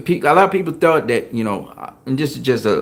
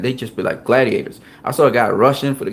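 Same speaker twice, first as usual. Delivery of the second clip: ordinary talk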